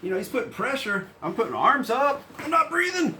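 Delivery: rising, tense panicked voice; panicking. Retold or imitated. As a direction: sound like you're starting to panic. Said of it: "I start to panic".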